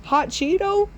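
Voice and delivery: very high confused squeak; hammed-up surprised squeak; shocked squeak. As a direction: blurt it out in disbelief. Confused panic.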